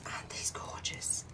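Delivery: slow, admiring whisper. Real, not acted. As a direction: breathe it out in a hushed whisper. Admiring stickers up close.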